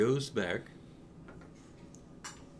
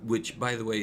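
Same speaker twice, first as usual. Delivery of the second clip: ordinary talk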